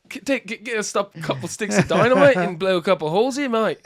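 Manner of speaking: high-pitched, loud Australian sales patter; mock Australian accent. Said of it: "That's your Australian accent?".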